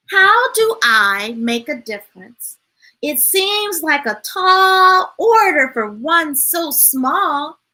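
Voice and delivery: a lightly sing-song storybook read; reading to children. Acted. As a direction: read gently to a room of kids. Reads picture book aloud.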